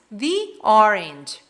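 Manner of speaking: "the orange" as "thee orange"; long ee in "the" before "orange". Shown as a demonstration. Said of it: "começa com som de vogal".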